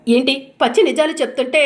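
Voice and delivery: loud, cutting challenge; sting him with it; loud taunt. Acted. Wife taunting her husband, loud and sharp.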